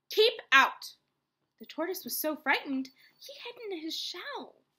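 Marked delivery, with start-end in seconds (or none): 0.1-1.0 loud, forceful order; gruff yell. A narrator's reading. Grumpy cactus Hank orders the tortoise away, yelling. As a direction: bark it at an intruder. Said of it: "yelled Hank".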